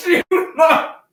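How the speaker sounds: high, loud shouted retort. Real, not acted. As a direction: fling it out at full volume. Shouted reaction amid laughter.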